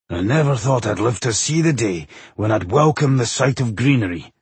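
grudging dwarf character voice; muttering dwarf. A narrator's reading. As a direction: grumble it to yourself as the dwarf. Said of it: "muttered Bowindil".

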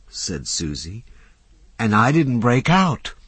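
put-on a young woman thrilled to tell her friend about dinner; excited Susie. A narrator's reading. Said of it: "eyes were shining with excitement".

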